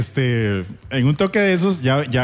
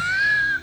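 very high put-on squeal; high mock wail; mock whimper. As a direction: imitate a tearful squeak. Acts employee near tears on phone.

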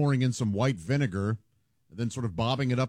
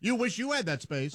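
mock gloat; 9 semitones higher, 3 dB quieter than usual. Gloating over parking space.

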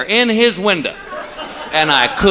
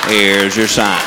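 much lower, flat-toned tag line; deadpan punchline; 10 semitones lower, much slower than usual. Acted. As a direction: drop the catchphrase low and dry. Catchphrase punchline after a joke.

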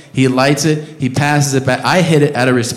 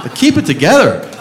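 raised, high-pitched alarm at a driver; urgent pleading; 8 semitones higher than usual. Retold or imitated. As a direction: plead urgently for him to focus. Acted alarm at stoned driver.

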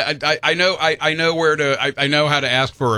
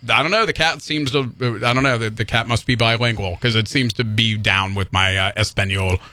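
ordinary talk

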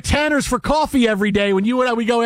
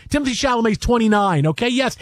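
normal talking voice

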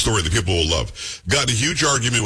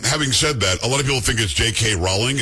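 ordinary delivery

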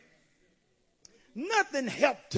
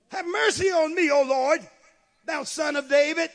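loud, high-pitched appeal; crying out; 7 semitones higher, 9 dB louder than usual. Retold or imitated. Woman calling on son of David, crying out. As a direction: cry it out like a preacher quoting.

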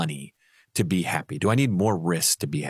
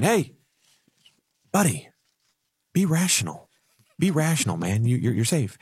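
higher, coaxing angel on the shoulder; a lightly voiced angel urging calm; angel Donald voice; 5 semitones higher than usual. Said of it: "the angel's on the other side saying".

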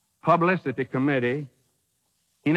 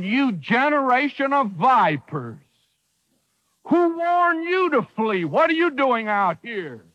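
fiery prophet rebuke; 7 semitones higher than usual. Retold or imitated. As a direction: thunder at them like John the Baptist. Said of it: "a preacher loses congregation that talks like that".

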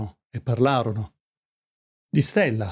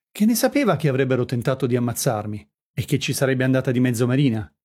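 usual speaking voice

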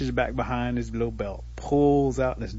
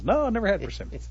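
pitched-up storybook answer; voiced Turtle reply; 9 semitones higher than usual. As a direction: answer in a higher character voice.

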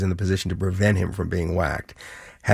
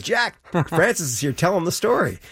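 higher-pitched mock calling out; Trump on speakerphone; 9 semitones higher than usual. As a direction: imitate hailing someone over a speakerphone, pitched up. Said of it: "so over the speakerphone".

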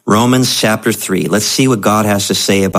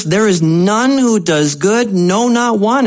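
higher, insistent and grave, no sweetness; bad news reading; 9 semitones higher than usual. Real, not acted. Pastor rereading the verse as the bad news, insistent. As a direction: deliver the verse as the hard truth. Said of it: "Uh, this is the bad news".

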